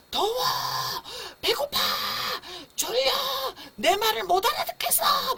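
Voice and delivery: very high, loud acted wail in words; screaming baby. Baby not understood after fifteen seconds of crying, screaming. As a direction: shriek each complaint like a frustrated baby. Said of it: "그래서 악쓰면 저도 잘 몰라요".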